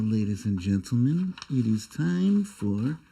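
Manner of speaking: ordinary talk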